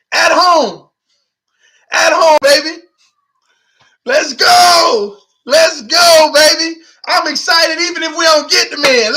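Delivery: loud, high, excited shouting; hyped cheering. Real, not acted. Fan hyping his team's Super Bowl run, shouting with joy. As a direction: cheer it like the title is won.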